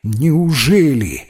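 slow, drawn-out wondering question; surprised. A narrator's reading. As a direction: stretch it in mild disbelief. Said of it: "удивился рыжий Рейли".

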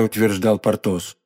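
ordinary delivery, nothing marked

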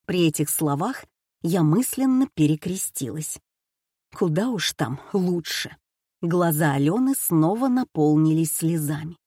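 plain reading voice

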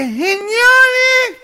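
very high, stretched nasal address; mock cleft-palate speechmaker; gangoso orator. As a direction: hail the crowd from the lectern, high and nasal. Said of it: "Se para en el atril y dice".

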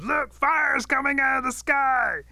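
high, drawn-out yell of alarm; loud, stretched-out cry of danger; alarmed shout. A narrator's reading. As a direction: shout a warning in mock panic. Rabbit raises false alarm.

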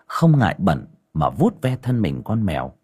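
plain reading voice

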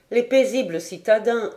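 ordinary delivery

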